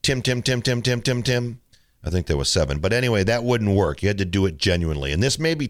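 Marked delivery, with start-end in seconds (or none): 0.0-1.6 rapid-fire name repeated mechanically; same name over and over on one note; rote repetition. Shown as a demonstration. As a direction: rattle off the name with no feeling. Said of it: "you couldn't go like".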